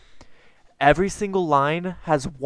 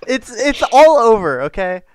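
excited insisting; 8 semitones higher, 8 dB louder than usual. Real, not acted. Insisting the poem is deep.

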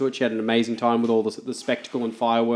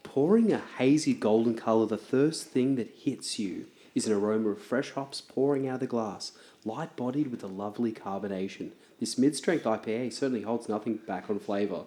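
nighttime radio voice; 2 semitones lower, 7 dB quieter than usual. Acted. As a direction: croon it like a late-night DJ. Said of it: "I've got a nighttime radio voice".

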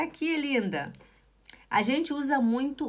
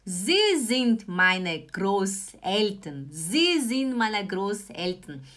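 slow model sentence; 3 semitones lower, slower than usual. Shown as a demonstration. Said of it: "teacher repeats slowly".

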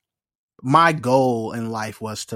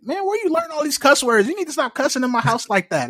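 much higher scolding mom voice; scolding mom impression. Mom catching her son cussing at home, high scolding. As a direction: scold your kid for cussing, pitched way up. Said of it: "And my mom like".

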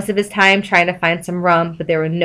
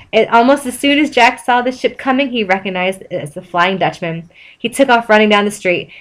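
ordinary delivery, nothing marked

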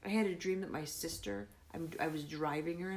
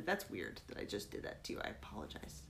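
embarrassed whisper; 10 semitones lower, 6 dB quieter than usual. Real, not acted.

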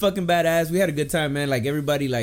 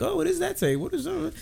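curious coworker; 4 dB quieter than usual. Retold or imitated. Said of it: "People come up to me like".

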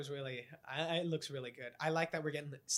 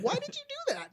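very high, squeaky squeal; mock high squeal close to the mic; 4 dB louder than usual. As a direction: squeak it like a cartoon mouse. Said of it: "let me get a little closer to the microphone".

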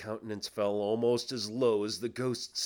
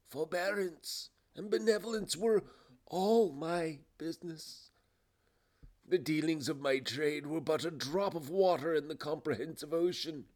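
higher, solemn ghostly lament; remorseful ghost; 8 semitones higher than usual. Acted. Ghost tells Scrooge what its business was, remorseful. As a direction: declaim with regret, pausing between items.

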